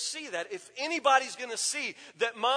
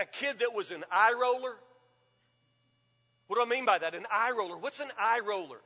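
ordinary delivery

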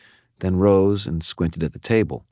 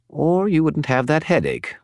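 higher, chattering spirit voice; a talkative skull spirit, pitched up; Bob the skull; 11 semitones higher than usual. Acted. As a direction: prattle on like a gossipy spirit. Bob ends.

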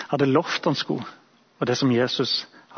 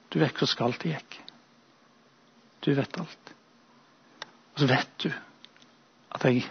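softer, halting confession with long pauses; despairing; 5 dB quieter than usual. Acted. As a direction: quietly pour out the guilt, piece by piece. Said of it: "At han blir fortvilet". (fortvilet, lavmælt bekjennelse med lange pauser)

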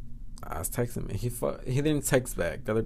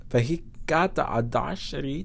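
usual speaking voice

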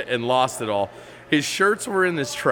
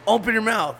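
Matt's order; 8 semitones higher than usual. Retold or imitated. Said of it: "When he was like".

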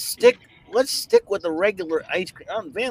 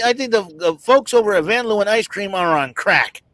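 ordinary delivery

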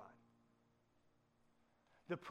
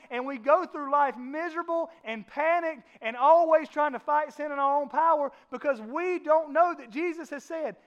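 louder and higher, slow, building; full-force preaching; 6 semitones higher, 9 dB louder than usual. Acted. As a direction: pound the point home from the pulpit.

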